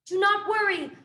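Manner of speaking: shouting reassurance through flames; yelled reassurance. A narrator's reading. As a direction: yell it over the roaring fire. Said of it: "Roz yelled over the roar".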